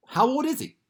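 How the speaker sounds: usual speaking voice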